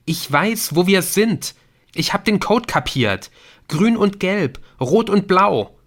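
high, loud, urgent; excited insistence. A narrator's reading. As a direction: make the others understand your discovery. Nick insists he solved the code. (aufgeregt beharrend, hoch und laut)

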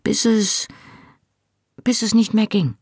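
falter, pause and start over; faltering over a delicate subject; hesitant. A narrator's reading. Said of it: "begann Hamish nach kurzer Pause zögernd".

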